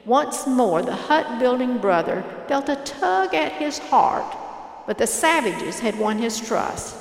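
usual speaking voice

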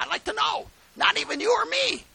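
high-pitched, emphatic indignation; indignant challenge. Real, not acted. Preacher to congregation, high indignant challenge. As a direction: challenge them, voice way up.

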